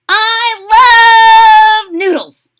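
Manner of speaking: very high, loud, drawn-out squeal; squealing little sister. A narrator's reading. Jilly Boo declares her love of noodles, squealing. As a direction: stretch it out in a gleeful high cry. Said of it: "declared Jilly Boo".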